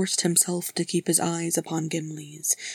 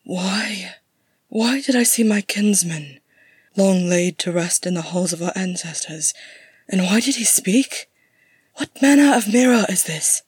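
bewildered; 5 dB louder than usual. Acted. Gimli questions what the mirror showed, bewildered. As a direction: build from baffled to demanding. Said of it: "frowning in bewilderment".

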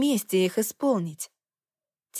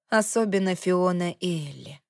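usual speaking voice

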